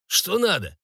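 high-pitched, servile question; obsequious. A narrator's reading. Cook turns to the baron's son, obsequious question. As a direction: lift the pitch and ask ingratiatingly. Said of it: "и угодливо добавил".

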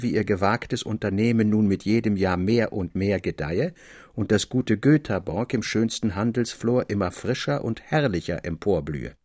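usual speaking voice

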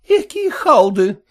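high, loud, slow scolding cry; mother yells at sleeping daughters, high and loud; shouting mother. A narrator's reading. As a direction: wake the lazy daughters with a shout. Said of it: "кричала она".

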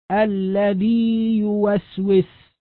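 الذي يوسوس recited very slowly, the long ya held before يوسوس; slow recitation example. Shown as a demonstration. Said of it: "أو". (تلاوة الذي يوسوس ببطء دون إدغام)

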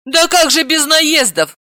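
high, loud exasperated outcry; aunt exasperated by her nephew. A narrator's reading. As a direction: throw up hands in exasperation. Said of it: "сплеснула руками Далила".

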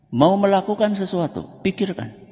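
ordinary talk, nothing marked

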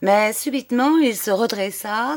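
ordinary delivery

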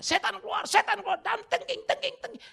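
high, loud mock exorcism yelling; hammed-up rebuking of demons; mocking shouted rebuke. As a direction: parody frantic deliverance shouting. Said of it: "Ayub nggak teriak-teriak".

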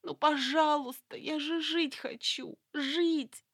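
high, slow tearful plea; desperate begging. A narrator's reading. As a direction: beg for your life. Terrified hostage begs for life. (отчаянная мольба)

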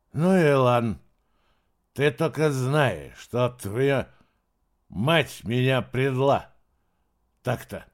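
put-on slow wounded drunk slur; sulky drunk, words dragging; blackout drunk. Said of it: "еле ворочая языком".

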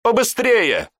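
high urgent shout. A narrator's reading. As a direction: hurry them up. Urging soldier.

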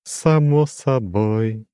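high, slow, chiming demon voice; lofty demon with a glassy ring; crystal ringing voice. A narrator's reading. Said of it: "прозвенел он". (высокий звенящий хрустальный голос демона)

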